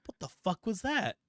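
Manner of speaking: startled doctor. Retold or imitated. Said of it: "He's like".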